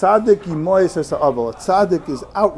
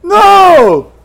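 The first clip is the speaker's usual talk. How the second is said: a sudden, very high, very loud shout of No; the rabbi's sudden loud shout of No; yelled no; 14 semitones higher, 14 dB louder than usual. Retold or imitated. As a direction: bellow it like the rabbi stopping him mid-act. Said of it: "started yelling".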